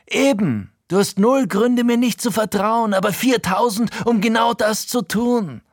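sehr hoch und laut geplärrt; brüll es heraus wie ein Mann, der völlig weg ist; plärrend. Acted. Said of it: "plärt er". (Frankie plärrt den Erzähler völlig außer sich an)

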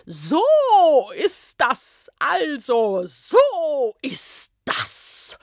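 high, slow, indignant official snorting. Acted. Ministry official bursting in on the witch, snorting indignantly. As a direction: puff up and snort it out. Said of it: "schnaubte diese auch augenblicklich los".

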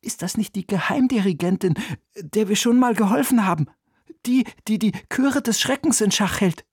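put-on a boy remembering their adventure; excited boy. (hohe, aufgeregte Jungenstimme)

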